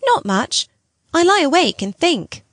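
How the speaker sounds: put-on playful boy. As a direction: tease her like a cheeky child. The boy Miles answering.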